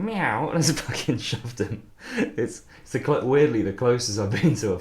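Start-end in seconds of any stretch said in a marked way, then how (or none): 0.0-0.5 a high, teasing little meow; mock cat meow. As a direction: imitate the guy meowing at you. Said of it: "went right up in my face and went".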